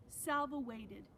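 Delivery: plain reading voice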